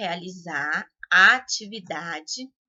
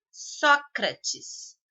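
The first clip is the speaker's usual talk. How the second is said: Sócrates pitched high on SÓ; stress on first syllable; 6 semitones higher than usual. Shown as a demonstration. Teacher showing pupils the strongest syllable, stress on SÓ in Sócrates. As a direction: hit the SÓ of Sócrates. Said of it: "a sílaba só é a de maior intensidade".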